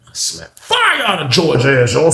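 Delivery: ordinary talk, nothing marked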